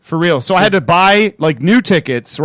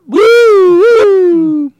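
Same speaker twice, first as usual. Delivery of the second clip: siren imitation; 7 dB louder than usual.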